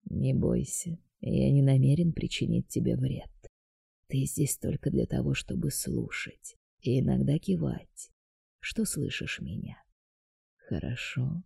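quiet sinister deity introducing himself; dark god voice. A narrator's reading.

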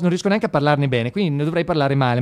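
normal talking voice

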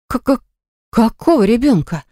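stammer, thrown completely; stunned stammer. A narrator's reading. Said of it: "спросила Марина с обалдевшим видом". (ошеломлённо, заикаясь)

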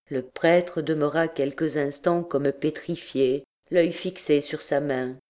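ordinary delivery, nothing marked

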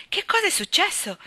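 anxious cry. A narrator's reading. Anna finds Janet in tears, anxious cry. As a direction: cry out in sudden alarm. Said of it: "gridò ansiosa".

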